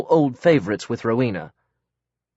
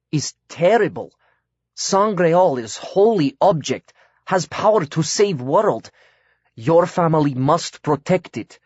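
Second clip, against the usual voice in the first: put-on slow, solemn foreign-accented broken English, a little higher; earnest foreign accent; 5 semitones higher, slower than usual. A narrator's reading.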